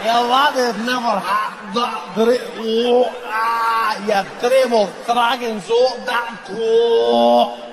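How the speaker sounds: mock a Scottish drinker groaning through rising sick; Scottish accent while retching. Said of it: "the Scottish had an accent. I've now learned that's just centuries of people trying not to throw up".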